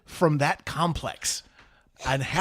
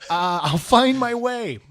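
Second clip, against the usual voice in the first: high, loud mock plea to Dad; hammed-up kid insisting he can manage; pleading son; 6 semitones higher, 6 dB louder than usual.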